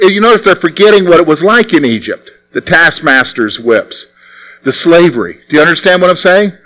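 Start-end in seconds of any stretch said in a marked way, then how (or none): none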